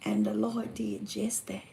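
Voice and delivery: slow, hushed whisper. Real, not acted. Telling how the Lord answered her prayer, hushed whisper. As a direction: let it drop to a reverent whisper.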